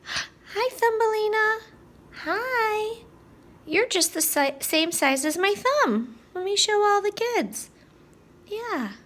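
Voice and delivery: high, bright doll-greeting voice; sweet high greeting. Pretend. Teacher greeting the little Thumbelina doll for the kids, high and sweet. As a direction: coo at the tiny doll like meeting a friend.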